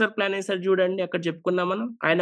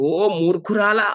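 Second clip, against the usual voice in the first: angry rebuke; 4 semitones higher than usual. Acted. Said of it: "ఎంత కోపం వచ్చేసిందంటే".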